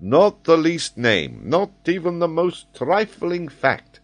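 emphatic, rising boast; proud geographer showing off; boastful. Acted. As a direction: insist on total mastery of the subject. Geographer boasts of his knowledge.

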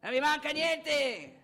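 high, very loud, drawn-out yell; insistent shout. Acted. Insistent outburst after calmer repeats.

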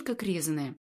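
ordinary delivery

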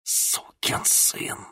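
slow, bitter whisper. Acted. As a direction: spit the curse under your breath. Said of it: "прошептала Хлоя".

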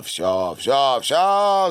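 loud cry climbing high; shouting. A narrator's reading. Savva flees the steam bench, shouting. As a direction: cry out that he has had enough. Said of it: "закричал Савва".

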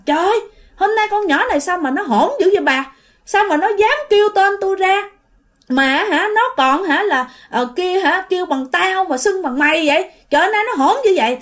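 acted grumble of an offended elder; angry uncle. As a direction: complain to his wife, mildly heated. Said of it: "cho nên khi nghe vậy là cậu tức liền".

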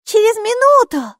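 put-on high, loud girl's call. A narrator's reading. Girl calling up to her father on the balcony. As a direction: shout up to dad.

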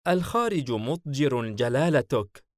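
high-pitched, displeased female figure. A narrator's reading. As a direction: complain with a touch of annoyance. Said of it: "سعلوة باستياء". (تذمر باستياء)

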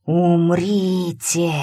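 very slow, drawn-out eerie command; a dead voice calling from the dark; ghostly voice. A narrator's reading. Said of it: "От потустороннего голоса". (потусторонний голос, очень медленно)

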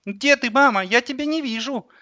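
put-on high-pitched little boy looking for mum; child voice. A narrator's reading. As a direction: ask as a small boy. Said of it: "Он говорил в телефон детским голосом". (детский голос)

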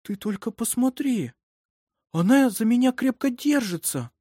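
high-pitched, marvelling voice; amazed. A narrator's reading. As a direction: exclaim in tender wonder at a baby. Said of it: "удивилась Карин".

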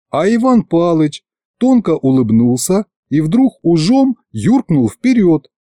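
ordinary delivery, nothing marked

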